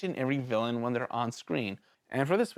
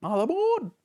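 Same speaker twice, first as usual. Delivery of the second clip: higher, louder ringmaster's call, falling at the end; second call, pitched higher and a little louder; 10 semitones higher, 4 dB louder than usual. Retold or imitated. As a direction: sing out the final boarding call. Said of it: "And then he goes".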